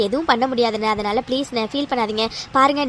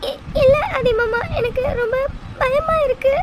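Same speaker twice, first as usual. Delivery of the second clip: high, frightened sobbing; crying like a child; 10 semitones higher than usual. Acted. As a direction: sob out the words like a scared child. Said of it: "சின்ன குழந்தை மாதிரி அழுதுட்டு இருக்க". (குழந்தை மாதிரி அழுதுகொண்டே)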